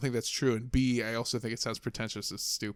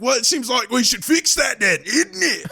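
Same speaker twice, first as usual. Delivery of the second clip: put-on loud, high, wobbly British accent rant; mad British boss; 10 semitones higher, 9 dB louder than usual. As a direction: imitate an angry British boss, badly. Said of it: "he'd get really mad".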